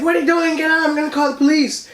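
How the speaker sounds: high-pitched mock grandma yell; retold yelling. Grandma threatening the intruder with police, imitated high yelling.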